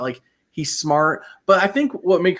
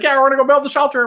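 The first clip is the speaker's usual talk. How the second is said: mock naive castaway; 11 semitones higher, 5 dB louder, faster than usual. Mocking the other castaways.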